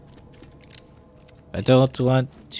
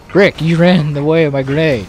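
annoyed call-out; 3 semitones higher, 7 dB louder than usual. Real, not acted. Teammate blocked his grenade.

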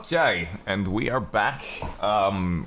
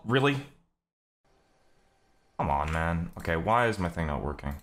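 ordinary talk, nothing marked

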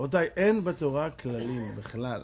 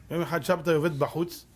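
ordinary talk

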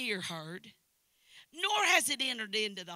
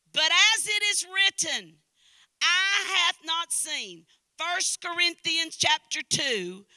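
higher, loud scripture reading with long stresses; preaching at full force; 6 semitones higher, 11 dB louder, slower than usual. Real, not acted. Preacher reading scripture to the congregation, full-force preaching. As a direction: proclaim each phrase like a revival preacher.

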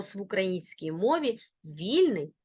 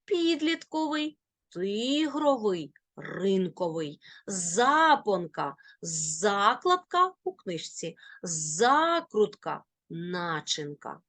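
підлітковий, тигровий, запонка with long stressed first syllable. Shown as a demonstration. Teacher drilling a word list, front stress. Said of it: "На перший склад падає наголос".

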